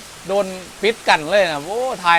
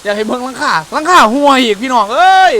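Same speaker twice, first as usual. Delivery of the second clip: calling out; 5 semitones higher, 9 dB louder than usual. Real, not acted. Calling viewers over rain noise.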